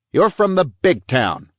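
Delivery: high, slow, jabbing cop voice; stern police chief. A narrator's reading. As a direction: talk down to him slowly. Weber's hard cop voice.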